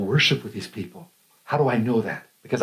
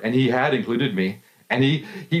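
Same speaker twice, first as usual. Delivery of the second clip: ordinary delivery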